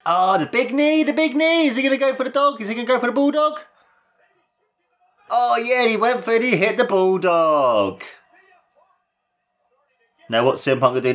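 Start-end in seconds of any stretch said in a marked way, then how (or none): 0.0-3.6 high, rising excitement over the big knee; excited commentary. Real, not acted. Excited play-by-play of a big move. 5.3-8.0 high excited call, then slowing down; excited yell. Real, not acted. Excited reaction to the bulldog.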